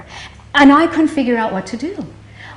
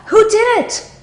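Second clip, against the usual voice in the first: much higher mock scream; retold scream; 12 semitones higher, slower than usual. Daughter screaming back at her mother after the news. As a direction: fling the question out in a panic. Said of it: "And I screamed out".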